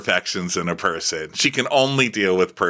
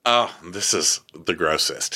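ordinary delivery

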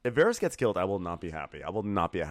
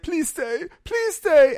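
mock begging in a much higher, louder voice; high pleading Brienne; 10 dB louder, much slower than usual. Host mocking Brienne begging Jaime to stay, high squeal. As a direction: squeal a hammed-up plea to stay. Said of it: "She's like".

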